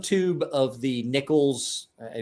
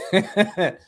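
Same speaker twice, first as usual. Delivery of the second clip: short laugh; 6 semitones higher, 4 dB louder than usual. Real, not acted. Laughing about dad story.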